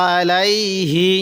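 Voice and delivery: আলাইহি with the ain pressed firmly, slowly. Shown as a demonstration. Teacher shows a slightly stressed ain, recited slowly. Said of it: "আইনটা একটু চাপ দিয়ে পড়ব".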